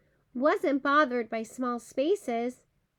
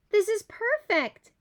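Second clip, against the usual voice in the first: yelled from the hole; 8 semitones higher than usual. A narrator's reading. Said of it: "yelled Bear from the hole".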